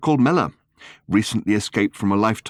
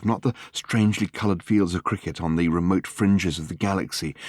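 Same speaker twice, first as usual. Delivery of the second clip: plain reading voice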